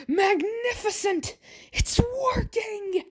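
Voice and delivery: high, slow exultant cry; high gleeful mad doctor; mad scientist glee. Acted. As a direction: crow it out in triumph up high.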